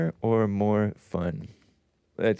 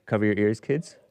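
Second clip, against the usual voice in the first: usual speaking voice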